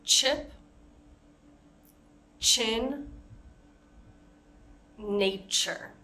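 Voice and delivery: chip, chin, nature with a short, strong ch; short forceful ch sound. Shown as a demonstration. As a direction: punch a crisp ch at the start of chip. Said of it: "shorter and a little bit stronger".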